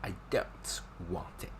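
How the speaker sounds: unvoiced, half-laughing refusal; mock refusal. Teacher acting a firm no as a playful whisper. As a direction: breathe it out as a joking refusal. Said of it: "Like, no".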